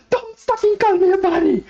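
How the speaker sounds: very high, fast, shrieking outburst; furious bunny. Acted. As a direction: snap at the man who called her a bunny. Bunny snaps at merchant.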